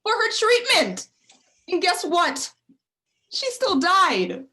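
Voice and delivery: loud, high, drawn-out shout; shouted, grief-stricken confession in an argument; anguished angry outburst. Acted. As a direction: let the painful truth burst out slowly and loudly. Acted quarrel, painful truth bursts out.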